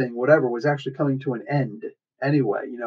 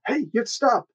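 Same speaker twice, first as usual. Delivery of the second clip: high-pitched plea; 10 semitones higher than usual. Retold or imitated. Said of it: "Wasp shows up".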